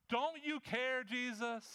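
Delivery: high-pitched, exasperated demand; frustrated plea. Acted. Preacher as Martha confronting Jesus, desperate and frustrated. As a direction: plead at the end of your rope. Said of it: "her frustration drives how she comes to Jesus".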